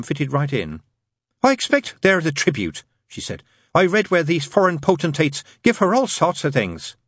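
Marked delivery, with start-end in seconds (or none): 1.4-2.8 high, eager nun voice; chatty nun. A narrator's reading. Nun chats about royal gifts. 3.7-7.0 high, eager nun voice; chatty nun. A narrator's reading.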